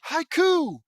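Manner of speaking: a name bursting out of nowhere; startled high shout; sudden outburst. Real, not acted. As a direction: blurt it out high and loud.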